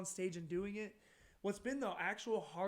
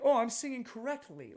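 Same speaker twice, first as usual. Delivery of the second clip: prim vocal coach; 4 semitones higher, 10 dB louder than usual. Retold or imitated. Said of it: "like a vocal coach singing".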